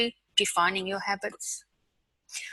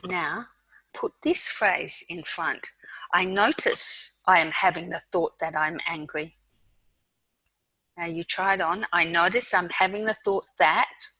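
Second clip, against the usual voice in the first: guided mindfulness. Acted.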